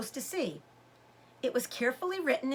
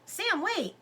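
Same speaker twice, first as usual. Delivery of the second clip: calling out; 8 semitones higher than usual. A narrator's reading. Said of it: "Lizzie called".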